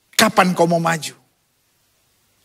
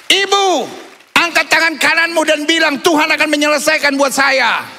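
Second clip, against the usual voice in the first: preaching at full force; 5 semitones higher than usual. Acted. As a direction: lift the congregation to shout it back.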